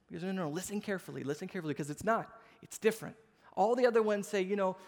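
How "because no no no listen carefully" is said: usual speaking voice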